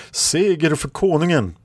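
high-pitched triumphant proclamation; victorious exclamation. A narrator's reading. Red Lady hails victory for the king, high exclamation. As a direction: call it out for the army, high. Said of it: "utropade Röda Damen".

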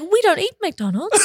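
high mock-disapproving mum voice; imitating mum. Mum objecting to Chris's McDonald's, high imitated mum voice. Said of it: "Mom was like".

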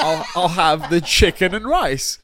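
slow, loud, laughing mock order; mock Charles ordering. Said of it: "has mad anger issues if he's not served his food".

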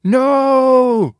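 high, loud desperate cry; scream. A narrator's reading. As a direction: wail it out, heartbroken. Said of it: "Cap screamed".

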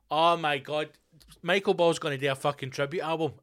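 ordinary delivery

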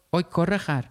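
calling out rudely. A narrator's reading. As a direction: call out an insult across the street, without full shouting. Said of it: "یکی با صدای بلند گفت".

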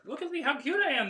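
showing off. Real, not acted. Showing off her outfit.

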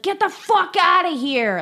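high-pitched imitation of an angry outburst; freaking out, aggressive. Host imitating Michael freaking out at the intruder, aggressive. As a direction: mimic someone throwing a person out. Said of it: "he freaks out and he's like".

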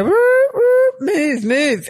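high, loud whooping "roo, roo"; mock urging. Racer urging her toad with a streamer, mock urging. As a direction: egg on a sluggish racer in high-pitched whoops.

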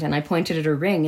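ordinary talk

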